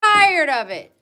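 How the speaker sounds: loud, high, fed-up shout; angry yell. Real, not acted. Owner shouting at her dog to stop barking, furious. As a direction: yell it out, sick of it.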